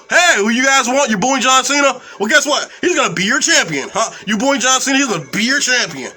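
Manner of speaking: mocking, punchy retort to booing; taunting promoter. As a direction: throw it in the fans' faces. Acting out dismissive promotion taunting fans.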